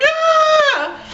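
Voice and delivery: high shrill childlike shriek retold; mock scared kid scream; a sudden high yelp of panic; kids' scream. Kids scream at smoke.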